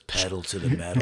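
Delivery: ninja master quote. Retold or imitated. Master to his flower ninjas, low mock-dramatic. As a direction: drop low for the movie line. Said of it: "he looks at me and he goes".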